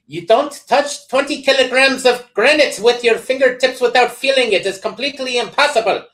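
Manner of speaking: mock Swedish curler, big and indignant; Swedish accent. Host quoting the Swedish curler in an over-the-top Swedish accent. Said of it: "tried to understand my Swedish voice".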